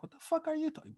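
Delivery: high-pitched incredulous question; retold bewilderment. Driver answering his shouting friend on the phone, mock bewilderment. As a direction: squeak out a baffled protest.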